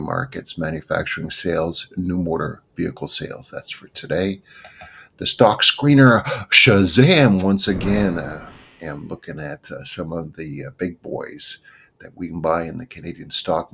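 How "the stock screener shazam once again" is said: higher, louder, punchy announcer call; excited announcement. Real, not acted. Host introducing his stock screener to viewers, higher and louder hype. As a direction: hype the segment name like a show host.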